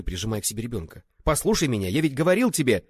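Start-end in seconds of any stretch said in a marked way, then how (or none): 1.2-2.9 raised, loud, insistent appeal; urgent pleading. A narrator's reading. Accused man trying to explain to his friend, urgent plea. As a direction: plead loudly to be heard out.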